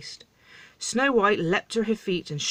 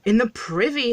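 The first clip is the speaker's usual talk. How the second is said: higher, louder scandalised howl; 5 semitones higher, 5 dB louder than usual. A narrator's reading. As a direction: cry out in exasperated disbelief. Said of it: "howled King Jeremy".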